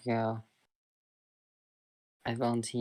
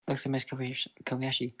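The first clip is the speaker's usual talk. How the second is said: ordinary delivery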